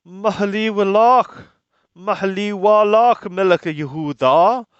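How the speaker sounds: a proud king's stern loud decree; loud royal warning. Acted. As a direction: call out the warning like a herald of Egypt. Necho's messengers' warning to Josiah.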